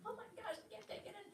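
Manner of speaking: high, tiny, hushed overwhelmed voice; overwhelmed squeak. Retold or imitated. Telling listeners about hormonal days, high hushed overwhelmed squeak. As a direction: squeak it out as if it's all too much.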